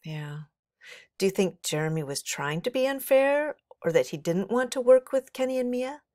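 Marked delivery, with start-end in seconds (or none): none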